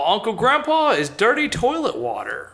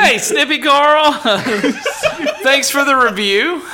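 laughing; 4 semitones higher, 3 dB louder than usual. Real, not acted. Teasing after giggle fit.

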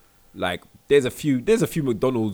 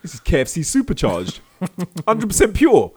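bouncer; 6 semitones higher, 6 dB louder than usual. Pretend. Bouncer busting smuggled food.

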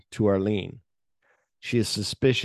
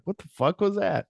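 imitated bafflement; 9 semitones higher than usual. Acting onlookers' baffled reaction.

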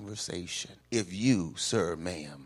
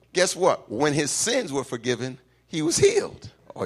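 ordinary delivery

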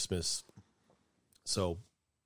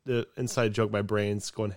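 usual speaking voice

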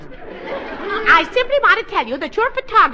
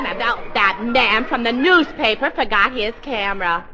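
normal talking voice

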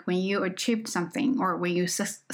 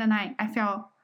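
ordinary delivery

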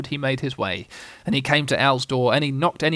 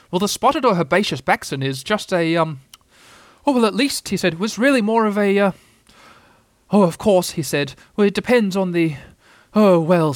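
high, louder owl voice, stalling; flustered bluffing Owl; 6 semitones higher, 5 dB louder than usual. Acted. Owl bluffs to Rabbit about Backsons, high and flustered. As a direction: bluff grandly and keep breaking off.

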